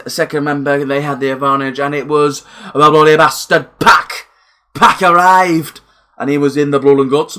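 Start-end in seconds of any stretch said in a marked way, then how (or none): 1.8-5.8 a hint of a Geordie accent, louder and slower. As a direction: slip lightly into Geordie for the wrestler's name. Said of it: "I don't know why I'm speaking like that, he's Geordie".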